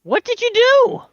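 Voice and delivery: high, loud alarmed question; shocked. Real, not acted. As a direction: blurt it out in disbelief. Shocked at derailed train.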